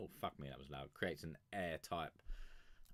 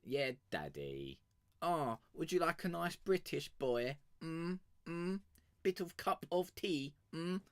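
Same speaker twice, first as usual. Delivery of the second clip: hammed-up flirty Brit, higher; 9 semitones higher than usual. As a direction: purr it to tease him. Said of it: "You dirty slag".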